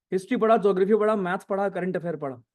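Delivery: fast run through the subjects; talking fast. Real, not acted. Teacher to students, reeling off the list fast. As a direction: rattle it off quickly. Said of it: "मैं fast बोल रहा हूँ यार".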